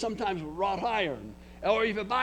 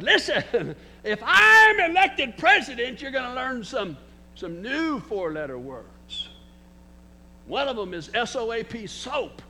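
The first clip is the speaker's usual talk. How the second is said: louder, higher mock politician's retort to the crowd; Wallace retort; 5 semitones higher, 9 dB louder than usual. George Wallace answering hippie hecklers, a hammed-up loud retort. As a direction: throw it back at the hecklers. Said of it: "Mister Wallace said".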